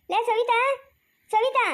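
high-pitched call for someone. Acted. Calling out for Savitha.

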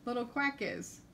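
plain reading voice

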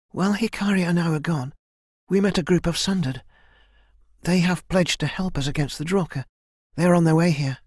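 put-on young warrior heroine, earnest. Kai tells the elder that allies are coming, earnest. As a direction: lift the voice into an eager, earnest fighter.

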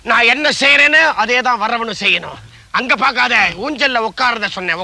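ordinary delivery

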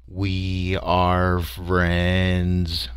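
very slow, drawn-out alien drone; drummed-out drone. A narrator's reading. Creature drumming its phrase while scurrying past. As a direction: beat each word out flat and slow. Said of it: "simply drummed out".

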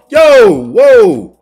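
high loud startled yo; real gasped shout; shocked yell. Real, not acted. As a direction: yelp in disbelief. Shocked reaction to a play.

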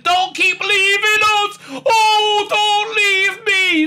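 high, slow, hammed-up crying; mock wailing plea. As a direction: sob it out like bad soap opera. Companion begs Doctor to stay.

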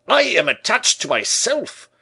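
loud, high-pitched bellow in character; indignant shout. A narrator's reading. Porthos rebuffs the tailor's question, shouting indignantly. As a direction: shout it with wounded pride. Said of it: "shouted Porthos".

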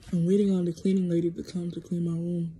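ordinary talk, nothing marked

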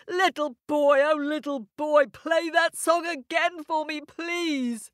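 high, cheery giant's wife. Acted. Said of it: "the giant's wife clapped and said".

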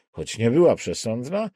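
ordinary delivery, nothing marked

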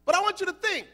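usual speaking voice

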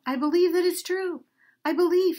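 bursting out. Acted. Donkey bursting out his faith to the man, loud and joyful. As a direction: blurt it out, overjoyed. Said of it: "The donkey burst out".

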